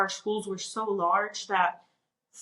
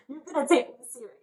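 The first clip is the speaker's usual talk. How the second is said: laughing through the words, high and quick; 6 semitones higher, faster than usual. Real, not acted. Retelling the applicant's plea to be taken seriously, laughing. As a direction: let a laugh break into the quote.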